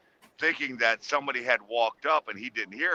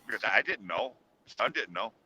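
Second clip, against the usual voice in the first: ordinary delivery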